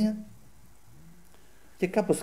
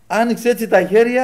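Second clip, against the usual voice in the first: usual speaking voice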